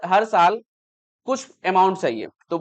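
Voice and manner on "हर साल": ordinary delivery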